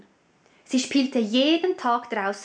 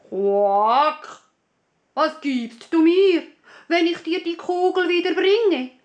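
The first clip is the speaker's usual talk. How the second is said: high, louder croaky frog voice with a quack; 5 semitones higher, 5 dB louder than usual. Acted. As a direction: play the little frog begging for a deal. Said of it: "fragte der Frosch". (hohe, quakende Froschstimme)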